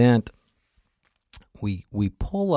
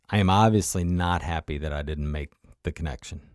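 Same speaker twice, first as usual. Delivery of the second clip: ordinary delivery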